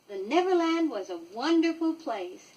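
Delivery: ordinary delivery, nothing marked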